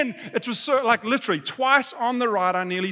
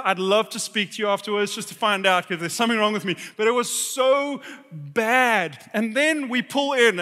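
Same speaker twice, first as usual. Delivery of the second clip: usual speaking voice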